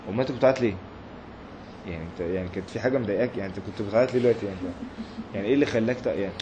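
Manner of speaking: soft, quick, teasing questions to the man who wept; mock puzzled questioning. Teacher mimics a scoffer teasing a weeping worshipper, softly and quickly. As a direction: needle him gently, half laughing at his tears.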